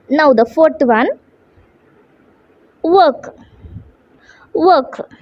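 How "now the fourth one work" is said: usual speaking voice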